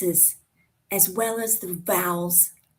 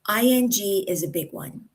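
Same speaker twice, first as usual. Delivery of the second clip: ordinary talk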